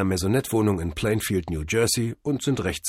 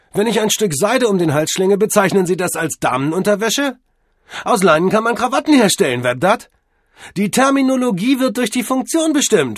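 loud, high-pitched indignant tirade; heated argument; 13 semitones higher, 9 dB louder than usual. Acted. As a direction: argue him down at full volume, voice pushed up. Cordell heatedly argues down the butler. (laute, empörte Streitrede)

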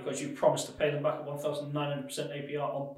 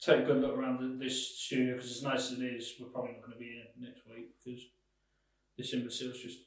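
low, soft, sinking resignation; quiet despair, fading away; defeated announcement; 6 semitones lower, 10 dB quieter than usual. Acted. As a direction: break bad news bitterly under your voice. Despairing address to studio audience.

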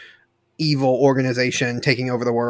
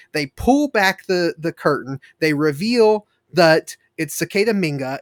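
normal talking voice

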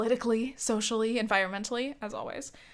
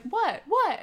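high, louder double "What?" in disbelief; mock shocked; 9 semitones higher, 6 dB louder than usual. Nathan reacting to hearing his drink is bad, shocked high "What?". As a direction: echo Nathan's startled outrage. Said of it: "And he's like".

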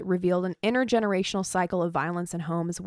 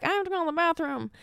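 put-on kid voice; 9 semitones higher, faster than usual. Retelling childhood excuse as a kid.

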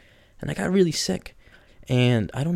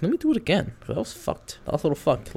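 high, animated self-talk, much higher; retold self-talk; 8 semitones higher than usual. Telling himself to try again, pitched up. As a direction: squeak out the retry in a pitched-up voice. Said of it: "I was like".

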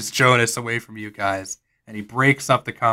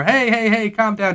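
soothing sheriff; 9 semitones higher than usual. Acted. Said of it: "Sheriff Rossi goes over".

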